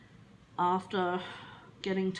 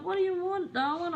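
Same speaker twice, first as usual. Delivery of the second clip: high doting mum voice; imitating mum; 11 semitones higher, 3 dB louder than usual. Mum asking dog what it wants, high cooing. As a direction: coo at the dog like a fond mother. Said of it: "mum's like".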